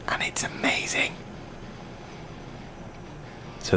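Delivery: hushed, voiceless whisper; confiding whisper. Real, not acted. Host confiding about the new album, whispering. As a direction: whisper it like a secret.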